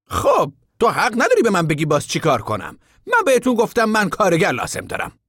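a high voice for the stranger; high contractor voice. A narrator's reading. Contractor asking the camp men about work, high voice.

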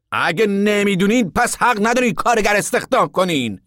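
irritated contractor. A narrator's reading. Contractor indignantly telling the camp he runs his business. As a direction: contractor asserting his right, annoyed.

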